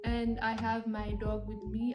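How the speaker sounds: normal talking voice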